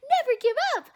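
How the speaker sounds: high, loud anime battle cry; anime hero shout. Shown as a demonstration. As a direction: belt it like a shonen hero. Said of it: "sounds very generic anime of".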